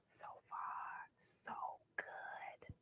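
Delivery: soft unvoiced whisper; whispered aside. A narrator's reading. Narrator whispering to listeners, hushed. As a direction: breathe it like a secret.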